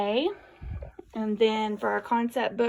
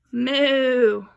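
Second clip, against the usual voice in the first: loud, drawn-out cow moo; 7 dB louder than usual. Shown as a demonstration. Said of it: "Who says moo?".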